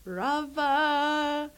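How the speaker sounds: lightly imitated Indian accent on Rava; a hint of an Indian cook's accent; shop owner's Indian accent. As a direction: say Rava the way the cook did, lilting. Mimics the cook's answer.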